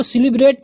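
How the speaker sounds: सेलिब्रेट quick, as "si-li-brate"; common mispronunciation. Shown as a demonstration. Teacher quoting people's mistake, सेलिब्रेट as "si-li-brate". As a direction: say सेलिब्रेट with "i" in the first syllable. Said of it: "लोग इसको सेलिब्रेट पढ़ते हैं".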